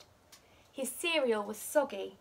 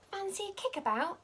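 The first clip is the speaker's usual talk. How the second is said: higher, bright friendly ask; eager friend; 9 semitones higher than usual. A narrator's reading. Buddy asking Pom Pom to play, eager question. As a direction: invite him kindly like a keen kid.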